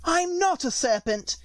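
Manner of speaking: high, slow indignant protest; insist, offended. A narrator's reading. Alice protests to the pigeon, indignant and high. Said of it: "said Alice indignantly".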